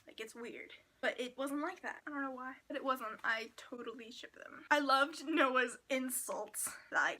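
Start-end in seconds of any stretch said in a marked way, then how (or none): 3.2-4.6 whisper. Real, not acted. Whispered confession.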